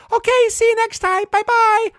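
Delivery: very high, loud squeaky greeting; friendly car bush. Retold or imitated. Talking bush greets driver's son, friendly squeaky voice. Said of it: "the conversations always end with".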